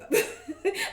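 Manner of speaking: short high-pitched laugh; quick laugh at oneself; amused laugh. Real, not acted. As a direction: break into a brief, high laugh. Amused by a saying she can't translate.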